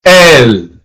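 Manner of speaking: él with a long, stressed e; él, drawn out with emphasis; emphasized él. Shown as a demonstration. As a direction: stretch the e in él. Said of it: "pronounce the letter or word with emphasis".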